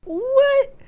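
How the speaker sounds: very high-pitched disbelief; squeaked, incredulous exclamation; mock astonishment. Co-host told she's right, mock astonished squeak.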